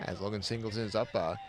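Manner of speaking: hushed golf announcer; low, hushed commentary like the Masters. Pretend. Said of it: "gotta use our golf voice".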